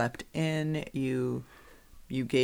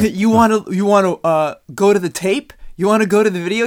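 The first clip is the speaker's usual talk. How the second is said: louder, challenging the coach to check the tape; older ref with attitude; 7 semitones higher, 12 dB louder than usual. Retold or imitated. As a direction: taunt him: go to the video. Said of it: "he got some attitude".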